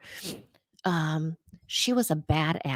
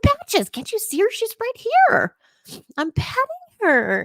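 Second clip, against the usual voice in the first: much higher, insistent voice; retold high-pitched pleading; 4 dB louder than usual. Telling mom the dead dog is right here, high and insistent. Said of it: "And I said".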